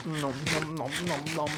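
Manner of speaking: soft playful chewing noises; munch quietly like a hungry kid; nom nom chomping. Pretend. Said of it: "I must eat".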